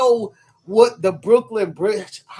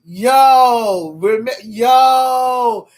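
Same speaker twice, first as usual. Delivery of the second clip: loud shouted yo to get attention; yelling for Ashley's attention; calling out; 3 semitones higher, 9 dB louder, much slower than usual. Real, not acted. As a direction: shout across the room to a friend.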